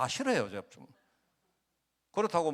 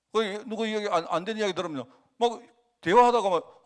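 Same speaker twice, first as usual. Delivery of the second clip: normal talking voice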